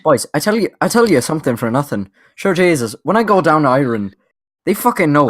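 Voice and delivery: quick, lightly put-on Irish accent; mock Irish storyteller, fast; hammed-up Irish accent, rattled off fast. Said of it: "That sounds more fucking Irish".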